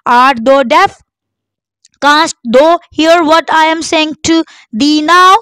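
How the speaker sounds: high, loud yell. A narrator's reading. King shouts at a beggar he thinks deaf. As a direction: shout so the deaf can hear.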